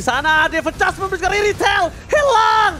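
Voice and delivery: shouted esports play-by-play; fighting game announcer. Real, not acted. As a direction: hype the team fight at full force.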